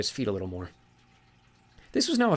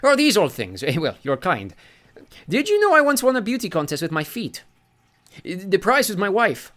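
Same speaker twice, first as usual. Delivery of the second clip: much higher and louder, delighted and vain; beaming sea god; 10 semitones higher, 7 dB louder than usual. Acted. Sea god basks in praise of his feet, beaming. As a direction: preen over the compliment. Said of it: "The god beamed".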